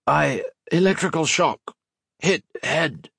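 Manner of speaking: high, halting rasp; disguised, hurt soldier stammering hoarsely. A narrator's reading. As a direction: rasp it in broken bits to hide your voice. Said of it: "Modo rasped, hoping to disguise his voice".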